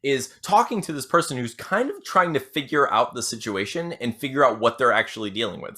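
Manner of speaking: normal talking voice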